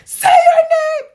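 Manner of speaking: high, loud, slow mock shout with a cry in it; yelling nurses. Nurses yelling at her to speak, a high slow shout. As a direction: bellow it like the whole room yelling.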